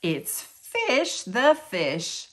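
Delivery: usual speaking voice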